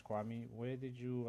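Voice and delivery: ordinary talk, nothing marked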